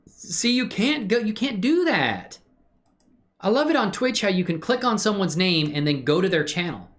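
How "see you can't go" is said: ordinary talk, nothing marked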